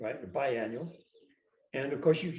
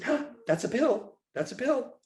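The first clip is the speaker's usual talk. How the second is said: mock nurse; 7 semitones higher than usual. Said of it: "She said".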